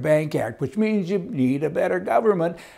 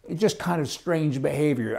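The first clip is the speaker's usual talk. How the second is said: ordinary talk, nothing marked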